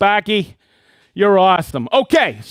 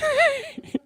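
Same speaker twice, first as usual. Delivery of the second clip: high laugh; 5 dB quieter than usual. Real, not acted. Laughing at a place name.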